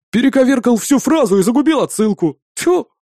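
high-pitched comic indignation; indignant. A narrator's reading. Bartender whose quotation was ruined, indignant. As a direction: protest in a high, offended voice. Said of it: "Возмутился Колыбалов".

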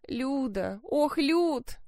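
sobbing her name, pitch breaking up; crying on the phone. Acted. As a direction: call out through tears. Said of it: "Неужели плачет?".

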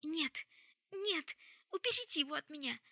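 high, loud terrified cry, short of a full shriek; panicked scream. A narrator's reading. Jessamine begging them to take the Brother away, high panicked cry. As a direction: wail in panic, pushing the pitch up. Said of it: "завопила Джессамина, срываясь на визг".

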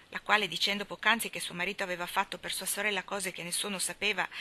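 ordinary delivery

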